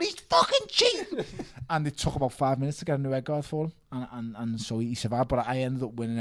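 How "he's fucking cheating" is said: high, slow outraged yell; retold yell. Boxer yelling at the referee about the cheat, outraged. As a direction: scream it like the fight is being stolen.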